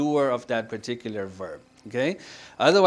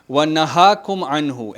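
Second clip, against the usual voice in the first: quick, loud chanted recitation; Quran recitation; 6 dB louder, much faster than usual. Acted. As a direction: recite the verse briskly. Quotes verse while teaching.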